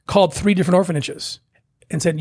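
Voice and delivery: usual speaking voice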